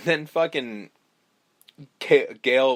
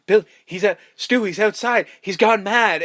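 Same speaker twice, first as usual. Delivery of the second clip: high, urgent mock panic; frantic pleading; 7 semitones higher, 4 dB louder than usual. Host acting out a victim begging to get in, mock frantic. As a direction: raise the alarm, pitched well up. Acted panicked plea from film.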